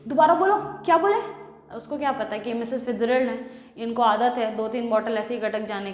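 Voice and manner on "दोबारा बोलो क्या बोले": loud, higher, daring him; loud furious wife's challenge; angry challenge. Acted. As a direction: hands on hips, dare him to repeat it. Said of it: "गुस्से से एकदम उठ करके बोलती हैं".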